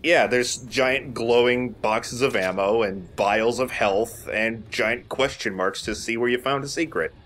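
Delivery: ordinary delivery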